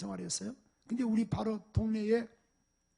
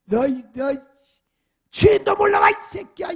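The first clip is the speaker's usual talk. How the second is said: much higher, much louder raging at the dog; furious yell; 5 semitones higher, 16 dB louder than usual. Retold or imitated. 주인을 몰라본 개에게 화가 나서 내지르는 분노의 고함. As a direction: scream it at the dog that barked at its master. Preacher yelling at the barking dog.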